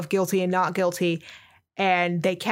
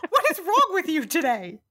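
exasperated disbelief; 10 semitones higher than usual. Real, not acted. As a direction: scold a friend in disbelief. Rejects "that's impressive.

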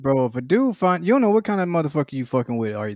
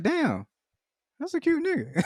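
high-pitched, breathy-free mock swoon, softer; mock admiring quote; 13 semitones higher, 6 dB quieter, slower than usual. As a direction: squeal it out as a fake-smitten aside. Admiring a guy on Snapchat.

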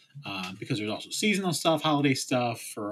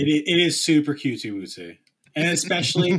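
louder, faster cutesy gush; 6 semitones higher, 7 dB louder, faster than usual. Pretend. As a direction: gush over something adorable. Gushing baby talk.

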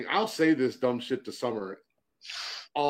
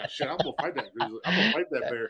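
normal talking voice

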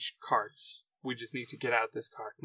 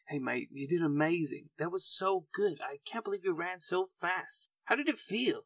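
cheerful NPC; 4 semitones higher than usual. Acted. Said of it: "and she's".

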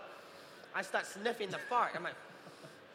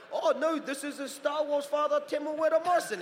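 mocking caller; 9 semitones higher, 4 dB louder than usual.

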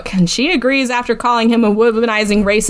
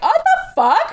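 shocked; 13 semitones higher, slower than usual. Retold or imitated. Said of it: "And Evie's like".

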